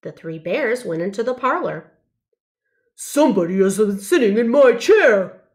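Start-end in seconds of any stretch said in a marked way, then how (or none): 3.0-5.5 big bear voice, louder; a big papa bear roaring at his bowl; booming papa bear voice with a mock roar. A narrator's reading. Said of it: "roared Papa Bear".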